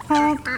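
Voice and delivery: the odd duckling can only honk. Acted. Grey duckling honking instead of quacking. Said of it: "he could only say".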